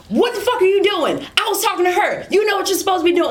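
high, fast angry sergeant yell; retold sergeant yelling again; back to yelling. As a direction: snap straight back into the tirade. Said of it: "Like, just going back".

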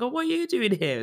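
lightly voiced mum caught off guard; surprised mum. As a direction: mum surprised to find her child at the door, high and delighted. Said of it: "And she's like".

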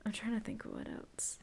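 soft unvoiced whisper. Real, not acted. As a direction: whisper it to herself. Whispered aside.

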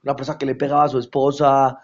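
usual speaking voice

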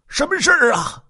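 high, loud cry of a question; startled father demanding answers; alarmed question. Acted. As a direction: shout it out in alarm. Father urgently questions son at scene.

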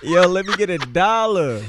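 laughing mock beg; giggling through the line.

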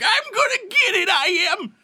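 put-on high, cracked old prospector holler. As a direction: yell it like a grizzled gold miner. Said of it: "Old grizzled miners, like".

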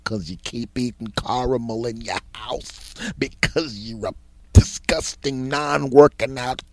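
drawn-out taunting insult; mock insult.

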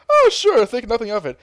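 high, eager little helper voice; cheery sidekick. Retold or imitated. Krillin agreeing to hand over a bean, high and eager. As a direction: chirp it like an overeager buddy.

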